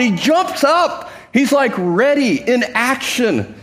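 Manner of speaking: preacher thrilled by the beggar jumping up; excited. Real, not acted. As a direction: build the excitement of the beggar's leap.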